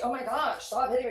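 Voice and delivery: high, loud mock pleading; hammed-up yelp of protest; mock victim's protest. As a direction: cry out as if being hit. Retold victim of hitting pleads.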